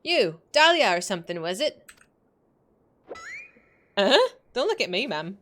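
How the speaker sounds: a hint of a Scottish mum, friendly; light Scots brogue meeting a stranger; Scottish mother. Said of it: "I don't know where this accent came from".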